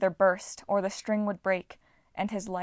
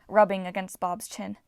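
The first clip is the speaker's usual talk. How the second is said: plain reading voice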